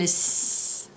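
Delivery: long voiceless hiss on "S"; pure S sound. Shown as a demonstration. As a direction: say "S" as the sound, a sustained sss. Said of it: "le son, on le dit comment?".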